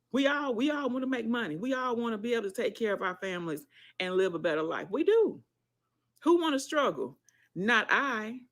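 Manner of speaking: climbing to a peak on "We do"; fired up. Real, not acted. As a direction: preach it, getting worked up. Fired-up pep talk to viewers.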